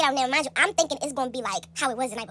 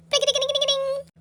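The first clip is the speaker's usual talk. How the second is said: high sing-song ding ding; phone ringtone; 11 semitones higher, 4 dB louder than usual. Retold or imitated. Imitating her phone ringing as mom calls.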